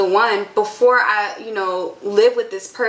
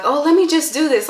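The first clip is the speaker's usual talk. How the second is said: mock careless voice; 7 semitones higher than usual. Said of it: "like, brainlessly".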